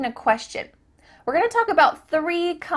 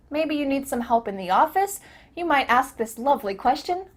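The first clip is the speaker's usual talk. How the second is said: usual speaking voice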